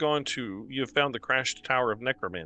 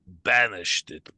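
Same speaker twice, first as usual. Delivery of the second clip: slow creature voice; 4 semitones lower, 4 dB louder, much slower than usual. Acted.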